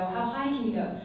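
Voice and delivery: normal talking voice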